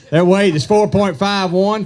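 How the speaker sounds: loud, slow call of the weight; weigh-in announcement. Real, not acted. Weigh-in emcee calling the weight loudly. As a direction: boom the weight out to the crowd.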